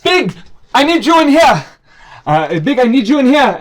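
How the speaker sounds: high, loud call into the room; high urgent yell for help. Acted. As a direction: shout for Big to come.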